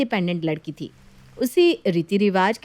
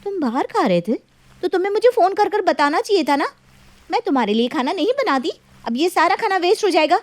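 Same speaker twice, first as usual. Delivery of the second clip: exasperated scolding; 8 semitones higher than usual. Acted. Wife scolding late husband.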